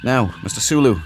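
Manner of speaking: usual speaking voice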